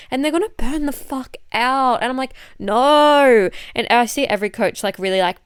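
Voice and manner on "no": emphatic no. Retold or imitated. Flat refusal to burnout plans.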